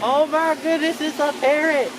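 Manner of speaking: high, loud and thrilled; excited. Real, not acted. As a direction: gasp with delight at the parrot. Excited at seeing a parrot.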